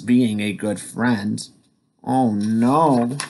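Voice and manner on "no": usual speaking voice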